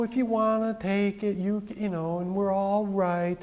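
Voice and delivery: mock wishy-washy. Preacher parodies teaching without authority, wishy-washy. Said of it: "They weren't wishy-washy".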